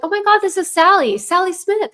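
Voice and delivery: high, loud gushing greeting; excited old classmate. Retold or imitated. High school acquaintance calling out of the blue, gushing. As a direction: gush like a long-lost school friend. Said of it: "somebody from high school".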